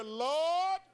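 loud, high prayer shout; declaring. Shown as a demonstration. Preacher leading congregation in a decree, loudly declaring. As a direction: cry it out high and loud to lead the crowd. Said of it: "Open your mouth and decree and declare".

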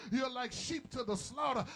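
ordinary talk, nothing marked

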